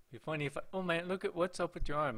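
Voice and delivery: normal talking voice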